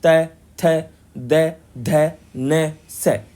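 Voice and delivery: तै, थै, दै, धै, नै, सै spaced out, tongue at the teeth; dental series demo. Shown as a demonstration. Teacher has students say the dental row, slowly sounded. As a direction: run through तै थै दै धै one by one. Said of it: "आप बोल के देखिए".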